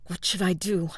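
forced out through gritted teeth. Acted. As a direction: speak with gritted teeth, holding back. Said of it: "he asked through gritted teeth".

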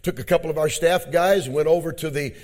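usual speaking voice

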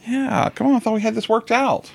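high-pitched playful complaint; mock exasperation. Parent joining in as Eliza, mock exasperated. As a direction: whine along as the let-down wife. Listener joins in as wife.

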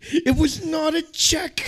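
high, slow, loud taunt; mock trash talk. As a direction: stretch it out like a cocky fighter. Mock fighter quote.

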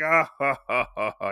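imitated scoffing "ha ha"; mock villain laugh. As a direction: laugh mockingly, like bad guys unimpressed by a kid. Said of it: "the bad guys are like".

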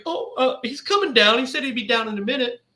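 high, light imitation of a wife; a hint of a nervous mother covering. Wife covering for the son, high light voice.

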